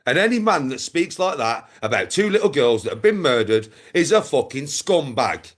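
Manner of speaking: ordinary talk, nothing marked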